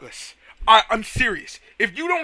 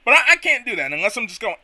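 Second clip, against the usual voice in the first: ordinary talk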